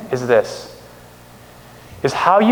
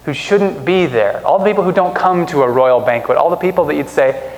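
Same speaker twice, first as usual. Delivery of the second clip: ordinary talk, nothing marked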